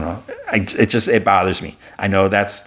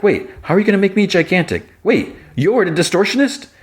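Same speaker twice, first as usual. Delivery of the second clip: much higher, quicker questioning; acted incredulous alarm; 13 semitones higher than usual. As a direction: voice a startled man, pitch leaping up in disbelief. Jimmy questions giantess in alarm.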